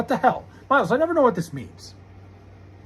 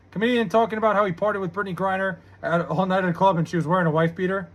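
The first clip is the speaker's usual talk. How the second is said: usual speaking voice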